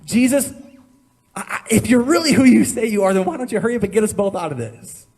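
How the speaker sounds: loud, high, angry challenge; angry demand. Acted. Dying criminal angrily demanding Jesus save them, loud angry. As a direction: spit the demand out in anger.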